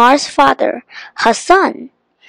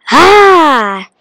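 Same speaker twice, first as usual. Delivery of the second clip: loud, high genie roar; shouted outburst; 5 semitones higher, 6 dB louder than usual. A narrator's reading. The evil genie appears before the children, shouting. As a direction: let the cry burst out loudly. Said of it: "he shouted".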